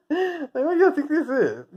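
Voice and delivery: laughing disbelief. Real, not acted. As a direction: ask it while still laughing. Mocking, laughing, high pitch.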